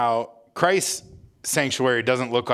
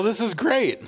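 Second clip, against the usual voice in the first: laughing; 12 semitones higher, slower than usual. Real, not acted. Laughing at the banter.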